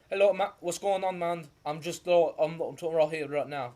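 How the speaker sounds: lightly put-on fancy British accent. Imitating British speakers for a friend, light British accent. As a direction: breeze in like a posh Brit, a touch of Irish. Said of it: "That's just British, or like, a fancy kind of Irish".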